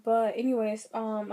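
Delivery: ordinary delivery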